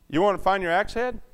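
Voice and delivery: usual speaking voice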